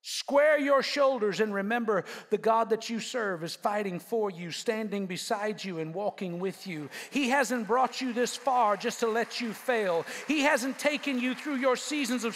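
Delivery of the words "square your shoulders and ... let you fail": ordinary talk, nothing marked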